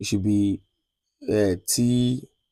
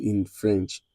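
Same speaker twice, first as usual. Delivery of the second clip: ordinary delivery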